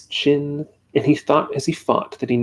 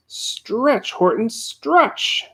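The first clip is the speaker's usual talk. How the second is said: higher, slow drawn-out call; yelling; 9 semitones higher, much slower than usual. A narrator's reading. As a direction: urge him on, stretching the words. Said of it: "yelled the bug".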